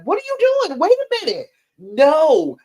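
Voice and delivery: a boss barging in, high and indignant; mock boss. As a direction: play the boss catching a slacker. Boss catching the employee.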